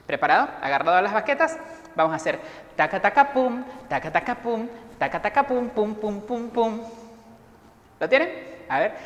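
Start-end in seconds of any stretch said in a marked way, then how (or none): 2.8-6.8 rhythmic vocal drum pattern, pitched up; drum imitation. Shown as a demonstration. Teacher shows pupils the drum part, imitating drums. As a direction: chant it like drumsticks landing on a drum. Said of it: "El primero que vamos a hacer es la batería".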